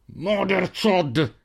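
very slow, drawn-out curse through clenched teeth; growled through teeth. A narrator's reading. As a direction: snarl the curse between your teeth. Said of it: "ruggì tra i denti".

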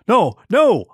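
high, loud alarmed protest. A narrator's reading. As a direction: push the sponge away, refusing. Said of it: "I protested".

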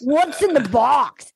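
slightly slowed mock voiceover line; lightly hammed-up, slow movie line; mock bad voiceover. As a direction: draw out the question like bad voiceover. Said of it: "they have the worst voiceover and she goes".

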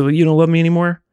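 half-joking plea, retold; kinda joking. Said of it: "kinda jokingly".

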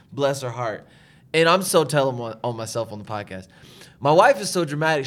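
normal talking voice

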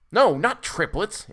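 slow, high, loud retort; cutting. A narrator's reading. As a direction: correct him with biting scorn. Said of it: "answered the doctor cuttingly".

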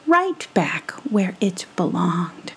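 ordinary delivery, nothing marked

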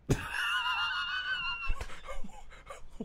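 very high, piercing burst of laughter. Real, not acted. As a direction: shriek with laughter at the quote. Reacting to his friend's quote.

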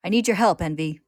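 high, loud, urgent appeal; calling out. A narrator's reading. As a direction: call out for an enemy's help. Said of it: "he calls".

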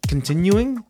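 "continuing?" asked back high in disbelief; incredulous question. Real, not acted. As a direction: question "continuing?" as if it's not a word. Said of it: "Yes, that's a word".

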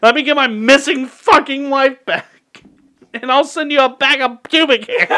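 high, hammed-up demand with laughter creeping in; yelling back. Caller demanding missing wife back, mock yelling. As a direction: yell it in mock desperation. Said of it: "you start yelling back".

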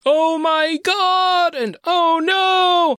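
mock American anime exclamations; Joseph's American exclamations. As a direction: ham it up like a JoJo dub. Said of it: "make Joseph sound American by having him go".

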